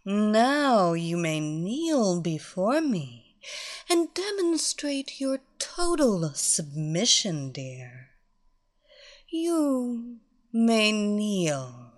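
very slow, drawn-out command; dominant mistress. Acted. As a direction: draw out every word of the order. Mistress orders kneeling.

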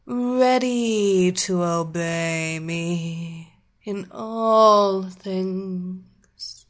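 slow, commanding hypnotist drone; slow hypnotic voice. Acted. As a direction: lull him under, slowly and firmly.

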